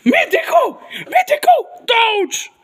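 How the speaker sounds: hammed-up excited yell; Homer cheer. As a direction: whoop it like a cartoon dad. Homer voiced after Bart.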